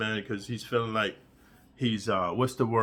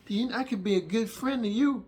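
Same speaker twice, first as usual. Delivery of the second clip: much higher mock-sweet voice; put-on coaxing voice; 9 semitones higher than usual. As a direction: pitch it up, coaxing and hammy. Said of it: "to say".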